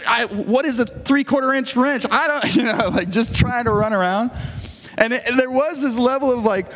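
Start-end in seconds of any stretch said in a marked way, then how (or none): none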